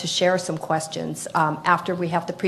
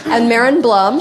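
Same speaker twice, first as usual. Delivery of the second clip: louder and higher name announcement; announcing loudly; 4 semitones higher, 6 dB louder than usual. Shown as a demonstration. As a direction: call the name out for applause. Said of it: "we'll clap for each person as we go along".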